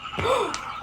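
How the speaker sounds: startled high gasp; sharp high gasp. Real, not acted. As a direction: gasp in surprise. Paint burped too much.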